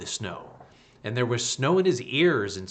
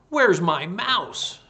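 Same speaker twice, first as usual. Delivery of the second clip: high, slow, louder cat demand; 8 semitones higher, 6 dB louder, slower than usual. Acted. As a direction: ask it like a greedy cat. Cat would have asked.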